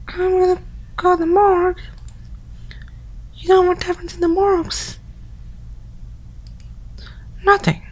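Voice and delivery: high put-on girly voice; girl voice. Yama's friend calling through the door in a high girl voice. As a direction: pitch way up into a girl's voice. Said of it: "my girl voice".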